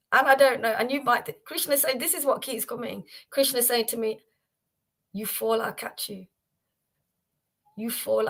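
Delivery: normal talking voice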